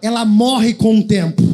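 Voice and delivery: loud call-and-response demo. Shown as a demonstration. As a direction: call it out loud for the congregation to repeat. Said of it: "Fala bem alto".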